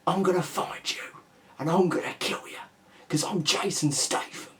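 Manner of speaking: mocking a whispery action star with a sore throat; Jason Statham impression. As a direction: rasp it out like a gravel-voiced action hero who's lost his voice. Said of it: "sounds like he's whispering to people all the time".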